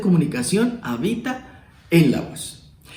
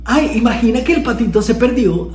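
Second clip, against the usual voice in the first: higher and louder, upbeat; bright, lively telling; 7 semitones higher, 4 dB louder than usual. Shown as a demonstration. Teacher demos a cheerful take on the lost duckling. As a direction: tell it like exciting news. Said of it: "No es lo mismo decir".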